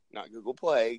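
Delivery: ordinary talk, nothing marked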